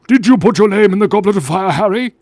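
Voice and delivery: hammed-up frantic wizard; Dumbledore. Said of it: "Dumbledore asked calmly".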